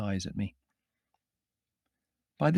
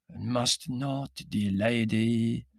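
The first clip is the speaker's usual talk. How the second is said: slow, solemn Middle European voice; sepulchral intoning; much slower than usual. A narrator's reading. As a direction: intone it like a voice from the tomb. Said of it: "he intoned sepulchrally".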